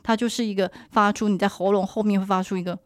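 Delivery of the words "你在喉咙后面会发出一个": ordinary talk, nothing marked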